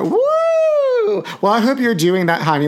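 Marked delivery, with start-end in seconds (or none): 0.0-1.2 very high, sustained woo; high "woo" cheer. Real, not acted. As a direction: let out a long high-pitched whoop. High cheer for Chicago Pride.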